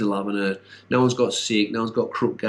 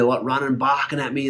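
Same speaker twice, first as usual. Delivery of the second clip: usual speaking voice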